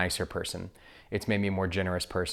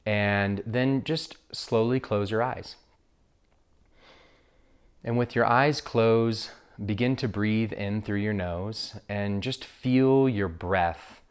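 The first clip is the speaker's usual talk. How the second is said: guided meditation. Acted. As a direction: guide listeners gently into breath awareness, unhurried.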